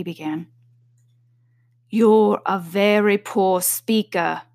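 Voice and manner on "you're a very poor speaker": slow dry King. A narrator's reading. King scolds Hatter.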